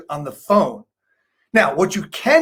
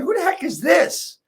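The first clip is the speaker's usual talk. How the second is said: puzzled listener; 9 semitones higher than usual. Retold or imitated. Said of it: "they're saying".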